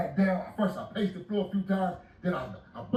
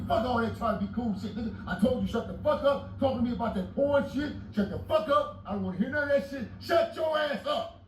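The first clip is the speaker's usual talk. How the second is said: yelling at the talkative cellmate, pitched up; retold yelling; 7 semitones higher than usual. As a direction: tell him off hard.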